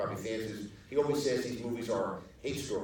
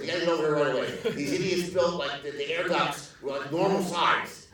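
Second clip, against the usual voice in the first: high, loud ranting phone call; mock outraged producer, pitched up and loud; irate producer; 5 semitones higher, 6 dB louder than usual. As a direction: imitate a boss raging over a blunder. Angry producer's phone call acted out.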